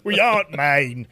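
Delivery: put-on a light Australian accent. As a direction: say it like a loose Aussie. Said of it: "Australian, maybe".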